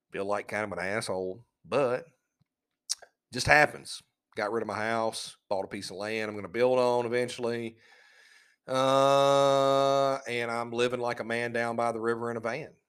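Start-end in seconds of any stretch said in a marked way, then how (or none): none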